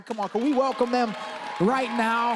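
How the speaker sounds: loud, fast call to the crowd; fast, shouted call for a welcome; rallying shout. Real, not acted. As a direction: whip up the congregation. Pastor rallying all locations.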